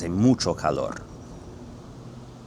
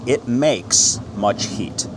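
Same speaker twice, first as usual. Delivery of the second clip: slow, deliberate word-by-word reading; half-speed literal translation; 3 semitones higher, 3 dB louder, much slower than usual. Shown as a demonstration. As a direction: give every word its own space. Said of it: "word for word".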